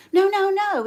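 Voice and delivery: high, loud, hurried interjection; hasty correction. Retold or imitated. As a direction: imitate an old man cutting in. Old man cuts in on wife.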